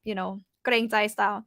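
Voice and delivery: ordinary talk, nothing marked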